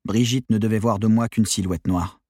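ordinary delivery

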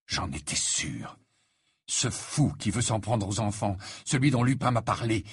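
ordinary delivery, nothing marked

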